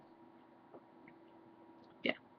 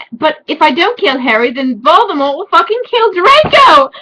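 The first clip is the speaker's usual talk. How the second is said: raised, frantic mock distress; overwrought outburst; 7 semitones higher, 6 dB louder than usual. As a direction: build to a loud, high melodramatic peak. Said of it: "I burst into tears".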